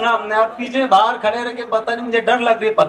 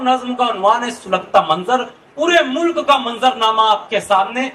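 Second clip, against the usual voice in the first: usual speaking voice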